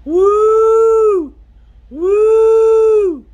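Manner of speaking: long, high, loud "woo", held; hollered cave call. Acted. Indian calling into a cave for an answer, high hollered hoot. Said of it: "he goes".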